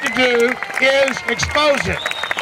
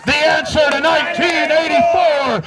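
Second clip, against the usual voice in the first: rallying shout; 2 semitones higher than usual. Real, not acted. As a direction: shout the slogan to the crowd. Chanted rally slogan.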